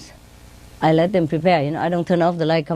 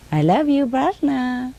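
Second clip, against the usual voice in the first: higher singsong declaration; parrot's affectionate imitation; 7 semitones higher, slower than usual. Parrot copies owner's endearment, high singsong. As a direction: coo it high like a pet bird. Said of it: "She say like that".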